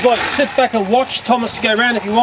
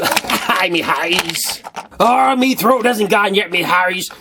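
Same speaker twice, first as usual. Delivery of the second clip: salty sea dog pirate; 3 semitones lower, slower than usual. Pretend. Vlogger greeting viewers, lower pirate voice. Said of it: "Starting to sound like salty old sea dog".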